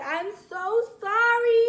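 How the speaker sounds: slow, high, emphatic apology; apologetic. Retold or imitated. Girlfriend calling boyfriend next morning, drawn-out apologetic plea. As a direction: act out pleading regret. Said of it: "called him the next day apologizing".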